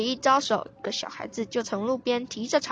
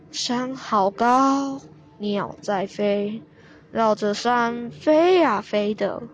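slow naive recital; slower than usual. Acted. Grandpa's naive slow poem.

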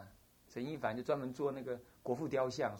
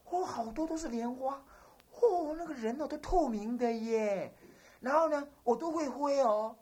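put-on dreamy child voice; 8 semitones higher than usual. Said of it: "他就讲说".

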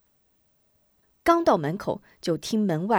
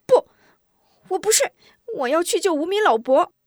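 put-on high, loud child protest; frantic clamour; 10 semitones higher, 6 dB louder than usual. Boy refuses bed to save old man, frantic loud protest. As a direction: cry out stubbornly in a little boy's voice. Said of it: "吴忠急得直嚷嚷".

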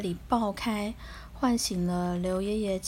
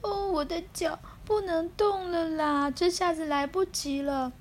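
crying; 6 semitones higher than usual. Acted. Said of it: "放声大哭起来".

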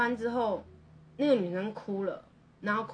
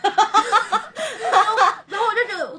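much higher and louder, bursting into a laugh; real laughter; 11 semitones higher, 11 dB louder, much slower than usual. Real, not acted. Laughing with the cohost at her own old behaviour.

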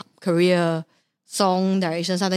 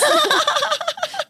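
high laugh. Real, not acted. Laughing at niece.

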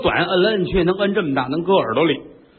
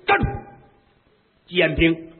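commanding shout; 8 semitones higher, faster than usual. Acted. 娘娘拦路喝问.

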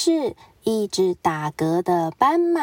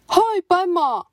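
high, bright greeting; cheerful tiger; 8 semitones higher than usual. Acted. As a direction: sing out a hello.

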